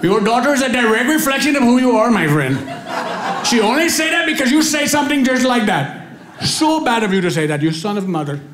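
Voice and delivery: high, agitated mock Indian accent; upset Indian accent. Upset stranger scolds the dad, imitated Indian accent. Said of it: "heard an Indian person cuss".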